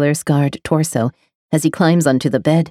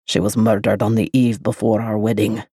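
lower, grave man; low grave male voice; 7 semitones lower, 3 dB quieter than usual. A narrator's reading. The lover telling of the murder, low and grave. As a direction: say it heavy and low.

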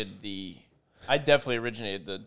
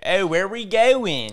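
loud, stretched-out Delco vowels, raised pitch; Delco accent; 7 semitones higher, 7 dB louder, much slower than usual. Shown as a demonstration. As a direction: call it out in slow, exaggerated Delco. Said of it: "you drop that Italian Philly part of it".

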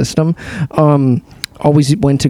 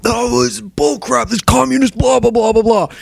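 ranting customer; 8 semitones higher than usual. Retold or imitated. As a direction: rant like an outraged cheapskate. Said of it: "And I'm like".

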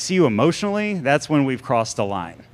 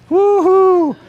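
high loud whoop; excited whoop; 8 dB louder than usual. Real, not acted. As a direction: cheer it out. Cheering crowd on.

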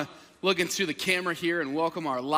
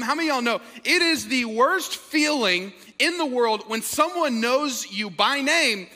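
ordinary talk, nothing marked

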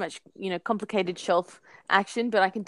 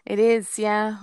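heavy studio reverb; 6 dB louder, slower than usual. Real, not acted. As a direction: voice drenched in echo. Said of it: "You sound like you're drenched in the worst studio reverb ever".

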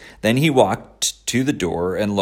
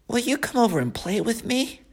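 high, whiny piglet voice; a disappointed young pig; 4 dB quieter than usual. Acted. Wilbur asks the goose.

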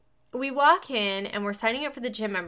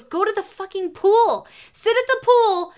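high, loud eager push; husband eagerly pitching alone time; excited urging; 9 semitones higher, 8 dB louder than usual. Retold or imitated. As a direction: sell it with excited enthusiasm.

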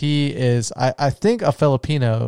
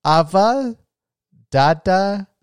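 imitated baby words; 7 semitones higher, 4 dB louder, slower than usual. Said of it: "where she was saying".

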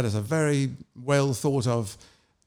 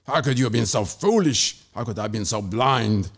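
seething; 3 semitones lower than usual. Acted. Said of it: "he seethed".

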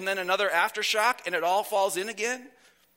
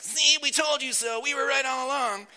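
higher, whiny gloating; 6 semitones higher than usual. Pretend. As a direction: gloat like a whiny know-it-all. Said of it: "that's my whiny voice".

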